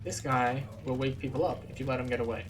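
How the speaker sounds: ordinary talk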